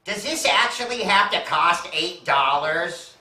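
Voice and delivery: a hint of an entitled star. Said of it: "this is exactly what she says".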